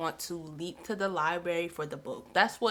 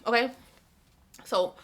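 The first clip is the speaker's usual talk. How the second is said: usual speaking voice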